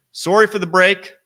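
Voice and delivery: loud, high Wisconsin-accented apology. Real, not acted. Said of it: "My Wisconsin accent coming out there saying sorry".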